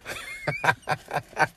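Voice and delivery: soft, breaking-up chuckle; amused laugh. Real, not acted. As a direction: laugh at your own accent bit. Laughs after accent bit.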